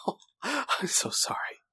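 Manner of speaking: a sly, whispered mock sorry; mock whispered apology. The villain whispers a mock sorry to the widow. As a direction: whisper the false apology with relish.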